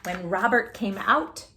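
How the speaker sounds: ordinary delivery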